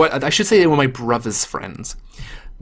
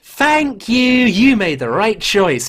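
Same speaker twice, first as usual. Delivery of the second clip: high, drawn-out gratitude; pleased and pitched up, stretching the words; delighted approval; 5 semitones higher, slower than usual. Real, not acted. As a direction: gush as if vindicated, laughter creeping in. Delighted at co-host's choice.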